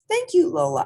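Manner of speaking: put-on high, loud child's thank-you; bright thanks. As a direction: chirp it out like a happy kid.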